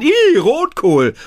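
high, slow, loud "ih" of disgust; a boy shouting that he hates red cabbage; disgusted cry. A narrator's reading. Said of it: "ruft Lukas".

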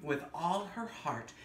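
gentle storybook narration with wonder; story reading to children. Acted. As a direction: read it like a bedtime picture book. Reads picture book aloud.

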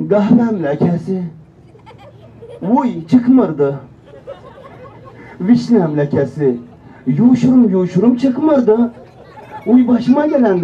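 loud, drawn-out Azerbaijani advert auntie; hammed-up wailing in an Azerbaijani accent. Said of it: "Bunu Azerbaycan T V'de izledim". (abartılı Azerbaycan şivesi)

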